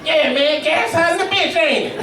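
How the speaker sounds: high, laughing stranger making small talk; chirpy clueless guy at the pump; gas station dude. Retold or imitated. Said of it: "he think I'm crying about gas prices".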